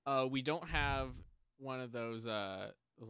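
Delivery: ordinary delivery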